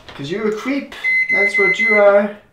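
high, loud playful jeer; jokingly calling the viewer a creep; mock taunting. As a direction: rib the viewer like a schoolyard tease. Joking insult to lingering viewer.

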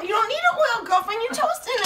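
you can't believe she asked; incredulous. Real, not acted. Incredulous at the oil question.

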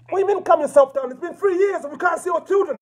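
real outraged shout at the operator; angry shouting. Real, not acted. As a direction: yell back, refusing to be calmed. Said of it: "until you calm down".

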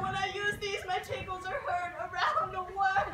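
usual speaking voice

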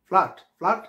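ফ্ল্যাট twice, loud and high, open "aa"; wrong pronunciation. Shown as a demonstration. Teacher calling out the mistake, loud "flaat". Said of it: "ভুল".

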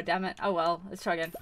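normal talking voice

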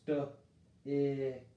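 "St-ick" drawn out slowly; sounding out. Shown as a demonstration. Teacher trying the short I, slow sounding out. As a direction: stretch the two parts. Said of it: "Let's try the short sound".

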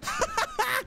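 short, very high laugh; quick squealed burst of laughter; high-pitched laugh. Real, not acted. Player recovering after the cardboard lady attack, high relieved laugh.

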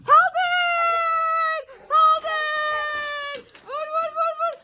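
high shouted charge; battle cry. Pretend. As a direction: yell the attack order. Ordering kids to attack the thief.

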